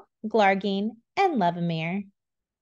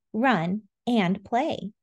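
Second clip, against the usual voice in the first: usual speaking voice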